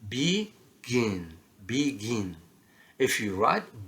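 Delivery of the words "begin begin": "begin" split slowly, weight on GIN; stress on second syllable. Shown as a demonstration. Teacher showing learners second-syllable stress, GIN in "begin" stressed. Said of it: "the stress is on the second syllable".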